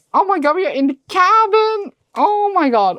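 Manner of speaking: high, loud thrilled squeal; can't believe what she's seeing. Real, not acted. To viewers over the special edition, an excited high squeal.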